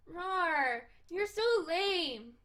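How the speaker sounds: loud, high teasing rawr; playful cat girl. Acted. Cat girl teasing her stuffy friend, playful and loud.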